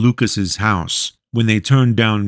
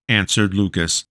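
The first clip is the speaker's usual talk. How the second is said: ordinary delivery, nothing marked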